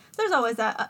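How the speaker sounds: ordinary talk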